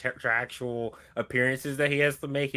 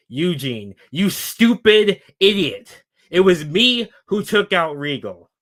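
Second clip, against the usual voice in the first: emphatic wrestling heel promo, raised pitch; Jericho; 4 semitones higher, 6 dB louder than usual. Acted. Wrestler turning on partner on the mic, emphatic promo. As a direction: declaim it into the mic, punching key words. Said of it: "Jericho grabs the mic and says".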